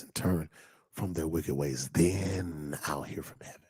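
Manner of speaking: soft, near-whispered preaching; quiet, intimate scripture reading; hushed scripture. Acted. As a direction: drop to a whisper so they lean in. Hushed scripture after loud preaching.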